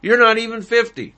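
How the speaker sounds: high, loud and fast mocking jeer; scoffing crowd. Crowd scoffs at Jesus's claim, quick high jeer. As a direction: sneer it like a scornful crowd. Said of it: "the crowd scoffed".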